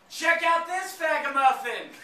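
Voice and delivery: high, loud mock heckler's yell; retold yell. Man heckling a passerby on the street, retold yell. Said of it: "I heard this guy yell out".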